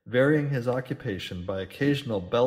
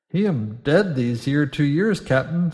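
nervous landlord; 3 semitones higher, 8 dB louder than usual. A narrator's reading. Landlord answers nervous captain.

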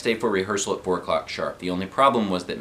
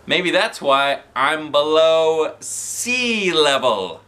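slow, high punchline in a goofy voice; milk the joke slowly; 7 semitones higher, 5 dB louder, much slower than usual. Acted.

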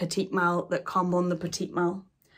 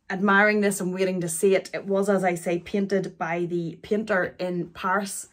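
usual speaking voice

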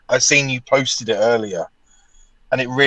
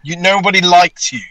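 mock rant; 5 semitones higher, 3 dB louder than usual. Ranting at bad spellers.

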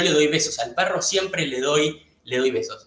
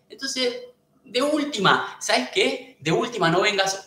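higher, lecturing tone; scolding; 7 semitones higher than usual. Real, not acted. Streamer scolding two-faced viewers, higher and lecturing. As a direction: tell them off from up high.

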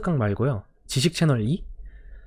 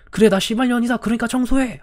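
furious retort; 10 semitones higher than usual. Retold or imitated. Teacher snapping back at the cursing student, furious. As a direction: fire it back at him in fury.

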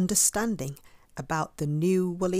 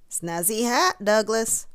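lightly bawled, raised voice; a sheep calling out, a bit higher and louder; bawling sheep; 4 semitones higher, 4 dB louder than usual. A narrator's reading. Sheep admiring Douglas's hat, mild bawl. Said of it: "bawled the sheep".

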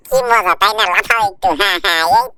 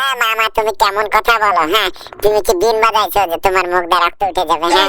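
much lower cartoon voice, teasing; low, teasing cartoon buddy; low cartoon sidekick; 10 semitones lower than usual. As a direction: drop the sidekick voice way down and tease. Sidekick mocks uncle in new voice.

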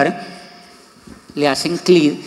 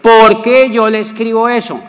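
louder, higher rhetorical punch; emphatic question; 6 semitones higher, 8 dB louder than usual. Real, not acted. To his audience, explaining his reply, loud and emphatic. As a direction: challenge the audience.

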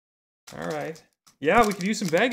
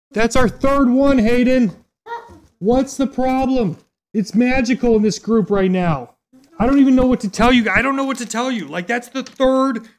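excited; 5 semitones higher, 8 dB louder than usual. Real, not acted. Third rare card pulled live.

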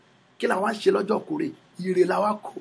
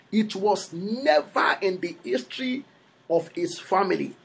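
usual speaking voice

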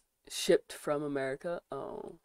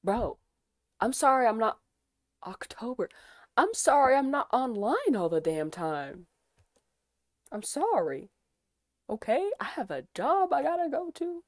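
defensive rant; 4 semitones higher, 6 dB louder than usual. Real, not acted. Defending herself to chat.

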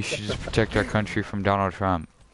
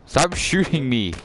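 shouting; 7 semitones higher, 3 dB louder than usual. Real, not acted. Teammate keeps shooting him.

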